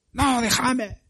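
high pleading voice brushing people off; refusing comfort. Acted. Pastor acting out a mourner who won't be consoled, high pleading.

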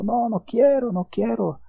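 put-on high, tearful refusal of a scared child; mock sobbing little boy; child crying. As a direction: plead like a frightened boy, near tears. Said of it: "y el hijo llorando".

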